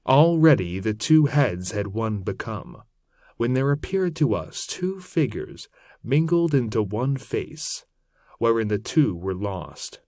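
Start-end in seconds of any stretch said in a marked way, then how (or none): none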